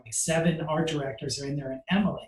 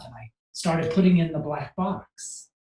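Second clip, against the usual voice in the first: ordinary talk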